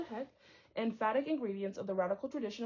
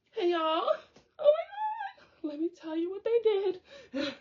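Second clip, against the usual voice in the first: hammed-up, much higher, loud teary gush; dramatic retelling; 12 semitones higher, 6 dB louder, slower than usual. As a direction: play it as an over-the-top sob-story greeting. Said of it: "a little bit more of a dramatic renditioning".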